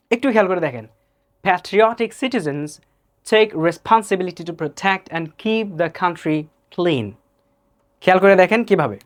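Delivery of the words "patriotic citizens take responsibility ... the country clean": slow model reading with aspirated p in Patriotic; careful American-style vowels in Patriotic and responsibility; accurate pronunciation. Shown as a demonstration. Teacher models the sentence for learners, accurate English pronunciation. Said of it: "accurate প্রনাউন্সিয়েশনটা কি".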